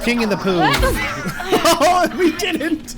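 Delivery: high laughing squeal. Real, not acted. Gamer laughing high at the Poop King. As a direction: laugh through the words.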